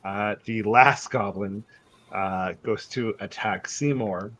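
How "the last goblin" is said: usual speaking voice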